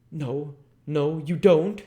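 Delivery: a high, loud cry of protest; sharp cry. Acted. Voice behind the parlor door crying out sharply in protest. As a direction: cry out to stop someone. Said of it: "a sharp cry of".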